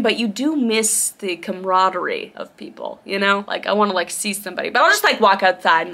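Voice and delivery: usual speaking voice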